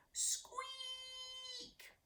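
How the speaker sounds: another tiny high squeak for a roar; squeaky roar attempt. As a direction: squeak it out again, high and thin. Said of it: "Ernie roared the loudest".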